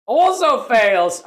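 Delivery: loud, high, stretched-out announcement; exasperated outburst. Real, not acted. Player seeing his Raider fail too, exasperated cry. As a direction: cry out the bad news slowly and loudly. Announcing another failed test.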